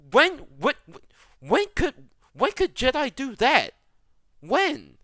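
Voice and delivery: high, loud, sputtering disbelief; incredulous outburst. Real, not acted. Ranting about force healing, in sputtering disbelief. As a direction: stammer it out in outrage.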